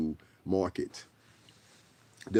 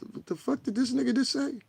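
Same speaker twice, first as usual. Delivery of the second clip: disbelief. Retold or imitated. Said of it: "I said".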